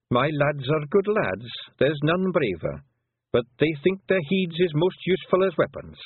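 put-on an old clan mother talking fondly of her lads; old Pictsie mother.

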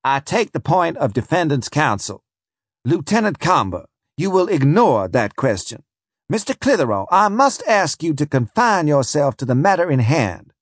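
the judge snaps his ruling at counsel; snapped. Acted. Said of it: "he shook his head and snapped".

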